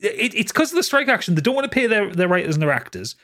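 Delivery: heated high-pitched rant; rant, voice climbing high. Real, not acted. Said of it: "Ranting coming".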